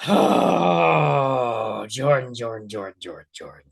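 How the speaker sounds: long groaned oh, name repeated sinking away; weary exasperation. Real, not acted. Viewer despairing at the debater, groaning wearily.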